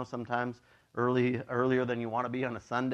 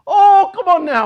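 very high loud drawn-out complaint; frustrated cry; 17 dB louder than usual. Retold or imitated. Pastor retells yelling at a car that cut in, very high and loud. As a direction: wail it at the cutting car. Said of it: "and I was like".

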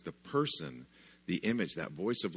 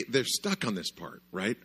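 normal talking voice

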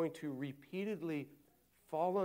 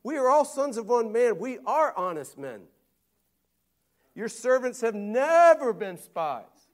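pleading brothers; 8 semitones higher, 11 dB louder than usual. Retold or imitated. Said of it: "They said to him".

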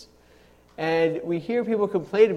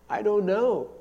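mock-tearful, higher voice of a crying daughter; imitated sobbing; 4 semitones higher than usual. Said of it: "She's sobbing and sobbing".

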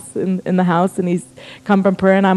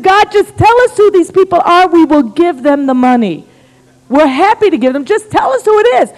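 raised, insistent prayer, acted out; earnest pleading; 8 semitones higher, 3 dB louder than usual. Couple asking God who gets the money, insistent pleading. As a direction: beg for an answer, pitch jumping up.

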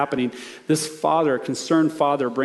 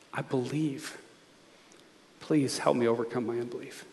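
quiet prayer; 4 semitones lower, 10 dB quieter than usual. Shown as a demonstration. Preacher models a prayer of faith.

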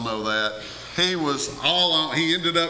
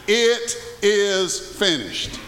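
solemn quote; 2 semitones higher, much slower than usual. Acted. Quoting Jesus on the cross.

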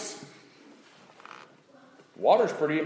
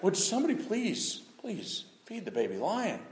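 much softer, pleading for the cub; soft plea; 2 semitones higher, 11 dB quieter than usual. Retold or imitated. Onlooker softly begging for someone to feed the cub. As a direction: beg quietly on the cub's behalf. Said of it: "and you're like".

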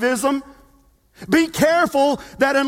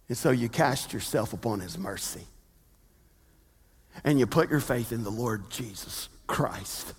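quiet solemn appeal; hushed preaching; 10 dB quieter than usual. Real, not acted. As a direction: bring it down to a near whisper.

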